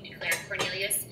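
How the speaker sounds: ordinary delivery, nothing marked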